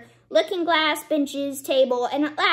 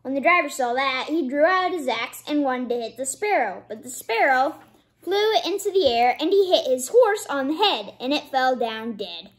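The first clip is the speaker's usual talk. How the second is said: plain reading voice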